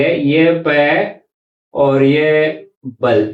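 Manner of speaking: पे and बल said very slowly, apart; slow syllable split. Shown as a demonstration. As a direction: draw out पे, then बल. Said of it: "इस तरह लिखेंगे". (बहुत धीरे, शब्दांश अलग)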